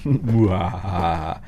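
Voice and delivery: slow, low mock villain laugh; hammed-up evil cackle; evil chuckle.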